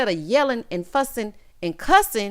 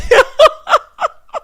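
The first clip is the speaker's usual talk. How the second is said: loud, high burst of laughter; big helpless cackle; 13 dB louder than usual. Real, not acted. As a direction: crack up loudly, high-pitched. Laughs at the buzzing panties.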